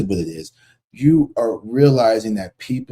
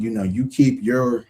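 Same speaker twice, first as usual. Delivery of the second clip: ordinary talk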